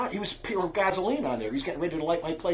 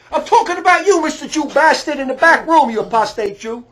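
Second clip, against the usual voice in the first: high and loud accusing yell; mock shouted accusation; 10 semitones higher, 14 dB louder than usual. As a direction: hurl the accusation loudly.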